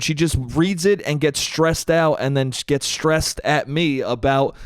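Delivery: usual speaking voice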